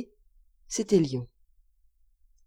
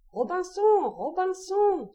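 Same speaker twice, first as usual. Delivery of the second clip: high, drawn-out plaintive squawk; strident, tearful parrot; 10 semitones higher, slower than usual. A narrator's reading. Abandoned parrot calls its master from the ship's end, strident and tearful. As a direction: stretch the name on a high, strident wail. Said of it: "une voix stridente, éplorée".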